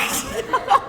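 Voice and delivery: high, shrieking laugh. Real, not acted. Laughing after dream retelling. (높게 터지는 웃음)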